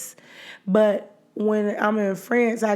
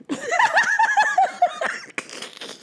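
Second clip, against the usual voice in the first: high laugh. Real, not acted. Laughs at herself.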